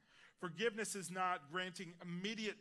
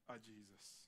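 hushed emphasis; 8 semitones lower, 14 dB quieter, slower than usual. Real, not acted. Sermon climax on Jesus paying debt.

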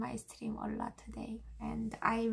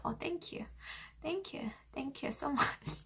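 soft breathy thanks; whispering; 8 dB quieter than usual. Real, not acted.

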